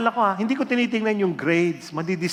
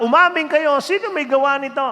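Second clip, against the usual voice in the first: high, loud, angry demand for a confession; furious shout; 10 semitones higher, 6 dB louder than usual. Retold or imitated. Angry advisor shouting at the students to confess. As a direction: yell at the class to own up, fast and hot. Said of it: "galit na galit yung advisor, umiiyak".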